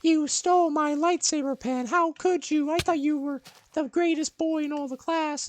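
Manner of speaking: put-on squeaky high accusing girl voice, loud; a girl loudly accusing a classmate; shrill girl accusation. Second-grade girl accusing boy of stealing, high loud voice.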